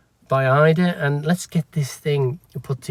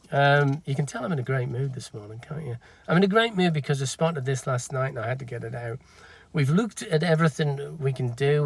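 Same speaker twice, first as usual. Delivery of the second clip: ordinary talk, nothing marked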